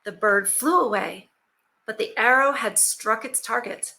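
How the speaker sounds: plain reading voice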